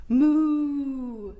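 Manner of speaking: a lightly voiced cow's moo. Shown as a demonstration. Teacher showing kids the cow sound, mild moo. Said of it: "what does the cow say?".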